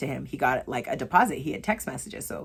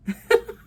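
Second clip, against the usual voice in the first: giggle; 4 dB louder than usual. Real, not acted. Laughs at herself.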